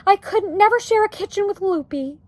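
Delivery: high-pitched, loud objection; crying out. A narrator's reading. Wife objects to sharing a kitchen, crying out. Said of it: "cried Homley".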